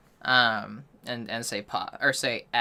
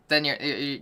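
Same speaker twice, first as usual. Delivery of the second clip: ordinary talk, nothing marked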